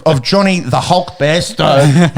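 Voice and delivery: ordinary talk, nothing marked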